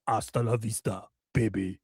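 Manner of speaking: low, soft Austrian action-hero deadpan; understated mock-Austrian tough guy; Arnold Schwarzenegger. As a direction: drop deep and quiet for the catchphrase. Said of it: "to see Arnold Schwarzenegger".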